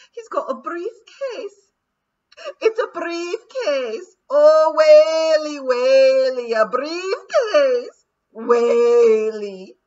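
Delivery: high, loud, slow keening wail; terrified pixie wail. A narrator's reading. A pixie terrified of a lawyer's briefcase, high loud wailing. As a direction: wail in horror.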